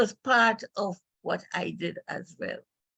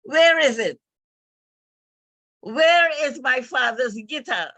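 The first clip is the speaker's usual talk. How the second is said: louder, higher retold outburst; sudden urgent question; 6 semitones higher, 5 dB louder than usual. Daughter suddenly asking where her father's guitar is, urgent and loud. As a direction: blurt it out, searching the room. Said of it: "she said suddenly".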